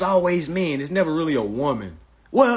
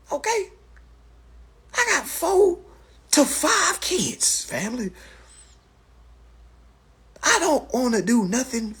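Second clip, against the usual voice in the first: much higher, pleading and fed up; exasperated rant; 11 semitones higher than usual. Real, not acted. Streamer ranting at a commenter, higher and exasperated. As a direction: pitch jumps up in disbelief.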